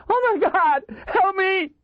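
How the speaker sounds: high, loud hysterical screaming; shrieking in sheer terror. A narrator's reading. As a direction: scream desperately for help. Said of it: "The hysterical voice echoed".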